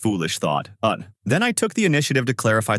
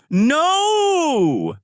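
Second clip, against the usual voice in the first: long, much higher loud scream of No; 4 dB louder than usual. A narrator's reading. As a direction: scream it in despair, held out. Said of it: "he screams".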